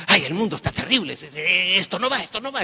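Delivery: mock a jittery lady complaining; nervous lady. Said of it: "Entró una señora nerviosa". (una señora nerviosa, apenas imitada)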